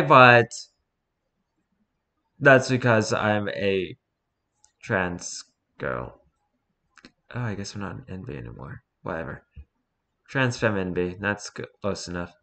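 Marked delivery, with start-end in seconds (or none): 2.4-12.3 soft, trailing-off mumble; breathy cutesy giggly. Real, not acted. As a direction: let it fade under your breath. Reading awkward post quietly.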